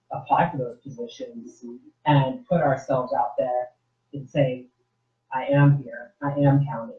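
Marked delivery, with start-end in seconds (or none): none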